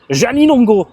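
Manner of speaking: loud, high shout of the name; delighted exclamation. Real, not acted. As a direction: cry out the name in amused astonishment. Découverte d'un auteur au nom comique. (exclamation amusée et ravie)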